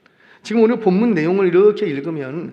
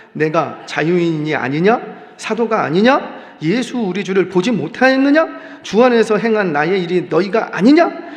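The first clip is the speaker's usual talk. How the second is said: indignant reading; 3 semitones higher, 6 dB louder than usual. Shown as a demonstration. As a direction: read it as Paul venting his anger, louder and louder. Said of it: "성경은 이렇게 읽어요". (분노한 어조로 점점 크게 책망하며 읽기)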